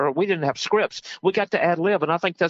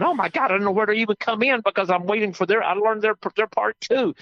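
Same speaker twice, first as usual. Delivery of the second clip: higher-pitched mock fluster; retold mild panic; 6 semitones higher than usual. Actor waiting for co-stars' scripted cue, mildly panicked. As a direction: act out being lost in a scene. Retells panic at ad-libbed scene.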